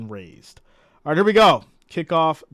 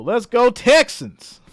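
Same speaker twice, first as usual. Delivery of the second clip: Southern twang, higher, louder and slow; 10 semitones higher, 10 dB louder, slower than usual. Shown as a demonstration. Streamer cheers a viewer's Texans chant in a Southern twang. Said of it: "feel like you gotta say that with a little twang to it".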